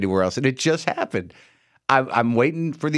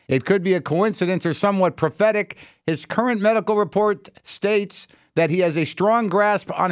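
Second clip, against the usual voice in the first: higher, measured quote-reading voice; reading out an email; 5 semitones higher than usual. Acted. Radio host quoting a listener's email about the senator, reading out. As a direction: read it out in a raised pitch. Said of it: "Uh, quote".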